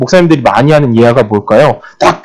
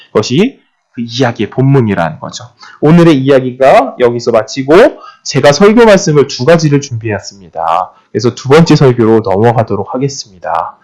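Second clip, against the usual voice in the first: ordinary delivery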